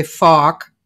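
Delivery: "fork" als "fahk", zonder r, met lange open a; dropped-r Boston "fork" said "fahk". Retold or imitated. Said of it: "he would say".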